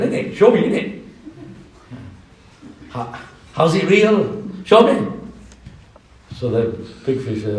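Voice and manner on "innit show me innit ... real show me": put-on cocky young lad, higher, half laughing; cheeky youth. Small fish mocking the big fish, cocky and teasing. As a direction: tease like a Leicester teenager.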